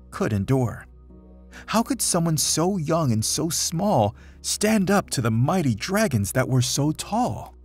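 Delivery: plain reading voice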